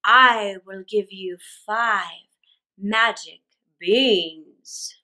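low, slow stranger voice; mysterious stranger. Acted. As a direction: draw it out low and secretive. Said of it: "The man answered".

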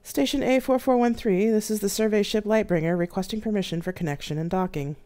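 a hint of a formal female ship officer; mild female radio voice hailing the station. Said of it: "A female-sounding voice came over the comms".

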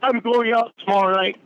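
high furious outburst; angry. Real, not acted. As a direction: spit it out in fury.

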